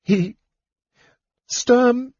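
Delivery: high, broken sobbing words; halting, tearful anguish; sobbing painfully. A narrator's reading. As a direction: force the words out between sobs. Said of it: "sobbing painfully".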